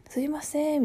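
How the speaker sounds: すいません pitched high, in standard accent; standard-Japanese intonation. Shown as a demonstration. Showing how people normally say excuse me, in standard accent. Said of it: "普通はさ".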